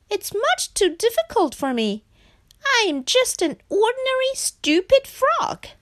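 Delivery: very high-pitched, plaintive frog; despondent frog. A narrator's reading. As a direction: lament your failure in a high frog voice. Frog gives up, sadly.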